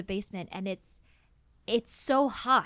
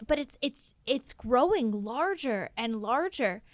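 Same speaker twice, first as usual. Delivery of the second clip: usual speaking voice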